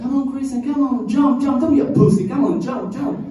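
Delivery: high, loud taunting yells from the pool; kids yelling. Retold or imitated. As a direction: shout up at him to jump. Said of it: "yelling at me like".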